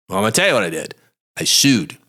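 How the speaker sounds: usual speaking voice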